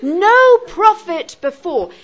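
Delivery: "No" high, loud and stretched; stress on No. Shown as a demonstration. Teacher demonstrating emphasis at sentence start, heavy stress on "No". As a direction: declaim it slowly with the weight on "No". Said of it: "putting the negative emphasis right".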